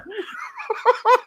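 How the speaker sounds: high-pitched helpless laugh; high laughter. Real, not acted. Host cracking up at the frog joke, high laughter.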